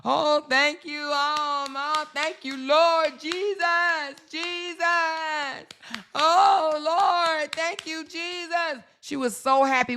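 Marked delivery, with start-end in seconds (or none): 0.0-8.8 high, slow, mock tearful praise; mocking her gushing gratitude, high and drawn out; mocking gushing thanks. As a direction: ham up her overjoyed church thanks. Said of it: "when she made that forty-five hundred dollars and she came out here and act like".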